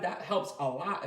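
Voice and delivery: ordinary talk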